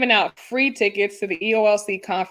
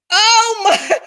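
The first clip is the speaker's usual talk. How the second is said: high-pitched, scandalized through laughter; laughing disbelief; 10 semitones higher, 4 dB louder than usual. Retold or imitated. Co-host reacting to the groom's confession, laughing in disbelief. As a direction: crack up while acting appalled.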